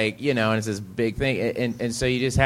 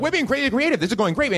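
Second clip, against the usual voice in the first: much higher, giddy party voice; coked-up friends; 13 semitones higher, faster than usual. Retold or imitated. Friends on cocaine bragging about their all-nighter. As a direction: ham up the wired partygoer. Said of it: "They're like".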